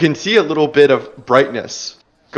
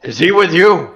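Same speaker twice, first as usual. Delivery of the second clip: lightly voiced dwarf, a bit louder and higher; gruff dwarf voice; 5 semitones higher, 4 dB louder than usual. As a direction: ask it like a suspicious dwarf. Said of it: "in Dwarvish".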